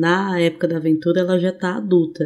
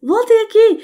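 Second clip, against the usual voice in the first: high, slow call while running; 4 dB louder, slower than usual. Acted. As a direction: shout after them mid-run. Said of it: "eu estou correndo falando". (chamando enquanto corre)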